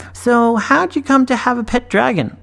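lightly voiced girl, high and breezy; casual young girl. A narrator's reading. Girl asking the boy casually. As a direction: toss it off as if it hardly matters. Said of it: "so casually".

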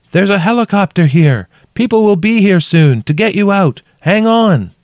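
high, loud shouted news; shouting. A narrator's reading. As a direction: shout encouragement down into the crack. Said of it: "I shouted".

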